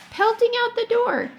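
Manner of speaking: sudden burst of urgency; excited narration. A narrator's reading. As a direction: rush the words like the running boy. Tom bolts out.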